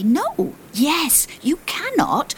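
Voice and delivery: high-pitched overlapping-style protests; clamouring passengers. A narrator's reading. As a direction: act out a rooftop squabble in a high voice. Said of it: "they say".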